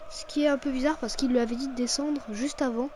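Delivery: ordinary delivery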